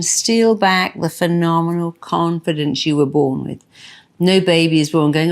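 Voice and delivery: normal talking voice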